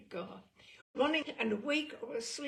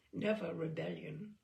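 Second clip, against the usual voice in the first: ordinary delivery, nothing marked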